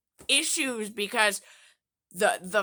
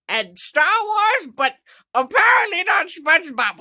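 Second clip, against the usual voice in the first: indignant outburst; 6 semitones higher, 5 dB louder than usual. Real, not acted. Indignant retelling, rising outburst.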